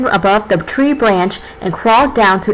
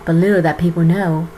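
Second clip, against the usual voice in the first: epic fantasy narrator; 4 semitones lower, 4 dB quieter than usual. Acted. Narrates fairy-tale opening.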